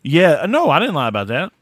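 sharp, indignant no; defensive denial. Real, not acted. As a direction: push back against the accusation. Accused of lying, denies.

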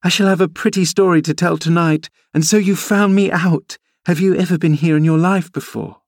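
put-on high girlish voice, playful and teasing; a spoiled girl showing off her adventure; lively young girl. A narrator's reading. Girl Cathy voiced in story.

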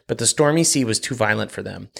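plain reading voice

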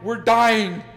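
loud, high mock panic shout; panicked yelling. The couple on the phone to nine one one, yelling in mock panic. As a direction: yell it like you think you're dying. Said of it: "calling nine one one".

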